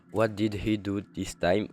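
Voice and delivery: slow English with a French accent. Real, not acted. Gamer reads the game's text aloud, French-accented English. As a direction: read it slowly in halting French-accented English. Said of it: "mon accent anglais".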